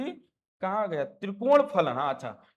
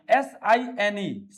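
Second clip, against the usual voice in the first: slow, letter-by-letter spelling of एस आई एन ई; slow dictation of एस आई एन ई; 5 dB louder, much slower than usual. Shown as a demonstration. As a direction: spell एस आई एन ई out slowly, one letter at a time. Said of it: "sine को हिंदी में क्या बोलते हैं".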